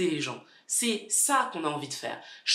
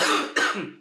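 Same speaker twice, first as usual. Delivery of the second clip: cough; 3 dB louder than usual. Real, not acted. Sick speaker coughs mid-sentence.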